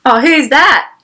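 normal talking voice

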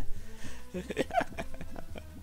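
high breathy giggle; helpless giggle; unvoiced giggling. Real, not acted. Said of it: "I don't know why I'm laughing".

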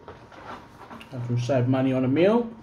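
speaking with food in mouth; mumbled between bites; chewing while talking. Real, not acted. Seen 1: Bites food at -1.0, jaw working with head tilted back.

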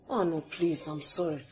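ordinary delivery

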